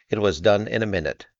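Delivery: ordinary delivery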